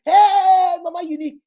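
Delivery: a loud, high "Hey" called out as applause; loud cheer. Retold or imitated. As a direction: cheer her name like a fan. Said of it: "who is clapping for you?".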